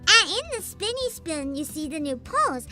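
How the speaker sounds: put-on cutesy baby-talk with r and l softened to w; sweetly babyish, lisping uwu speech. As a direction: talk like a tiny uwu mascot. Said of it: "when I have an uwu redeem".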